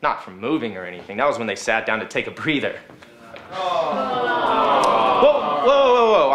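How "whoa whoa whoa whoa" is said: rising, louder hammed-up whoas; mock surprise. Host faking shock at the news, loud hammed-up whoa. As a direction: act shocked for the crowd.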